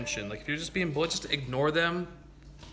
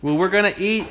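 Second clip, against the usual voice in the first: louder raised call; yelling across the room; 5 semitones higher, 9 dB louder than usual. Real, not acted. Calling Lola to dinner.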